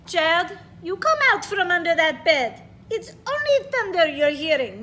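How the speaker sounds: loud Russian grandma calling; Russian accent, coaxing child out from under the bed. Acted. Grandma calls the child from under the bed, loud Russian accent. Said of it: "I'm trying to read with a Russian accent".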